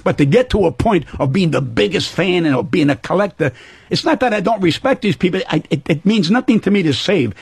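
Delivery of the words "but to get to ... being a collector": ordinary talk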